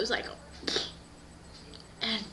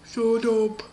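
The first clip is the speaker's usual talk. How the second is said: loud, booming order; gruff doorkeeper; 5 dB louder than usual. Acted. Guy behind the door silences everyone, loud booming voice. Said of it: "behind the door was like".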